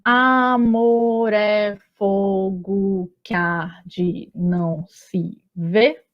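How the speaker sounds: very slow, each poetic syllable drawn out: "Amor é fogo que arde, não se vê"; escansão sílaba por sílaba. Shown as a demonstration. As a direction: scan "Amor é fogo que arde, não se vê" slowly, running the meeting vowels together. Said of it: "a gente confere assim". (escandindo "Amor é fogo que arde, não se vê" bem devagar, sílaba por sílaba)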